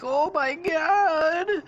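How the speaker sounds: high, loud, drawn-out cry; mock terror. Characters running away, high hammed-up scared cry. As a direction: wail it slowly in pretend terror. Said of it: "they're scared to death".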